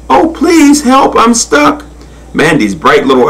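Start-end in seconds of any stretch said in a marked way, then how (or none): 0.1-1.8 high-pitched acted plea; pleading cry. A narrator's reading. Stuck crocodile begging for help, high pleading cry. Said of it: "she cried".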